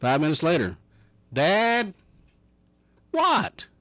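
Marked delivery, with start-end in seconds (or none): 1.3-1.9 put-on child calling. Boy calling third time. 3.1-3.7 a sharp high exasperated What; father at the end of his patience, snapping; exasperated yell. Acted. Father losing patience with his son, snapping high.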